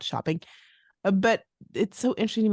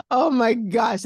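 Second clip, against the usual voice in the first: louder, bright "Oh my gosh"; mock surprise; 4 semitones higher, 6 dB louder, slower than usual. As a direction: gasp it out. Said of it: "She's like".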